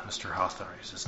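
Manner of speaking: usual speaking voice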